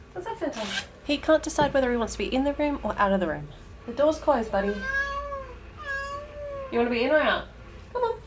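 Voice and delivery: high sing-song pet voice; talking to a pet. Real, not acted. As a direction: coo at the cat. Talking to the cat.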